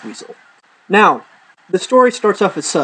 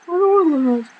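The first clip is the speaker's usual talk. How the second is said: high whining protest; whiny refusal; 12 semitones higher, faster than usual. Retold or imitated. Girlfriend whines back, refusing to close her eyes. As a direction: whine it like a kid.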